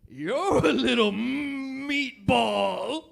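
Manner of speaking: loud, slow jolly Santa jab; mall Santa. Retold or imitated. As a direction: boom it out like a mocking Santa. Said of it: "He goes".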